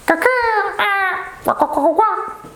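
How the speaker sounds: high-pitched squawking hen; chicken clucking. Pretend. Teacher acting out the animal, chicken clucking.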